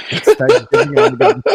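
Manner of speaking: high, loud words through real laughter; laughing. Real, not acted. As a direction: crack up while trying to talk. Cracking up at his own banter.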